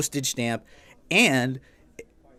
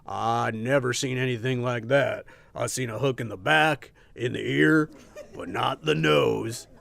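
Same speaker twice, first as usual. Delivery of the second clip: put-on old sailor voice; 4 semitones lower than usual. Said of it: "the old man of the sea".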